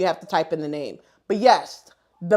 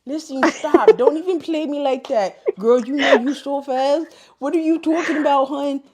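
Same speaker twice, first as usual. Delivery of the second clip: put-on higher-pitched Haitian-accented scolding; 5 semitones higher, 4 dB louder than usual. Imitating her Haitian friend telling her off, Haitian accent. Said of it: "her Haitian accent".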